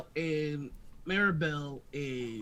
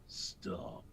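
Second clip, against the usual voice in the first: low, menacing command; deep, stern stop; 6 semitones lower, 5 dB quieter than usual. Acted. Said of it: "you hear a deep voice go".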